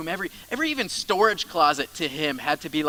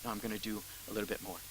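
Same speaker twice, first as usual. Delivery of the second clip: quiet self reminder; 6 semitones lower, 14 dB quieter, faster than usual. Retold or imitated. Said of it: "maybe you just need to be reminded".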